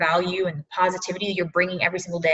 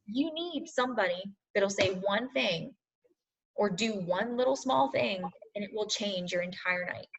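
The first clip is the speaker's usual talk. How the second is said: real sadness, much softer; 11 dB quieter than usual. Real, not acted. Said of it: "I can feel myself getting more and more sad".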